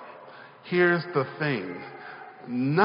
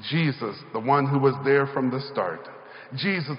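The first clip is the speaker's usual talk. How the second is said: usual speaking voice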